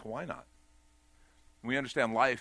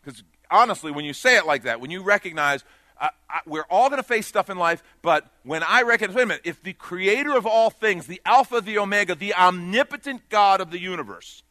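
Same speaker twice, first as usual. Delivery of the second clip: loud, rising preacher's call, higher; preaching at full force; 7 semitones higher, 12 dB louder than usual. Acted. Preacher urging the congregation, preaching at full force. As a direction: drive the point home to the whole church.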